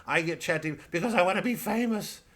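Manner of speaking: a hint of a needy showman; mock Tom Short. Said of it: "Tom Short up there going".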